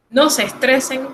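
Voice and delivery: normal talking voice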